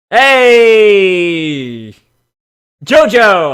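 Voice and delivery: loud mock greeting shout; calling out. Streamer hollering at the pony. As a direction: yell his name across the room.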